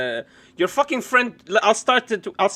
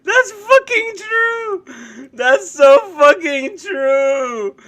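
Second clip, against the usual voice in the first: high, slow words squeezed out through tears of laughter; wheezing out the words mid-laugh; laughing so hard it's near sobbing; crying with laughter; 11 semitones higher, 8 dB louder, much slower than usual. Real, not acted.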